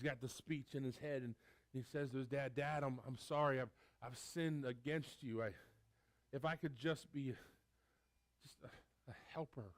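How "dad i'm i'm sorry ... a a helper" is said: son's rehearsed apology. Acted. Son's rehearsed speech voiced.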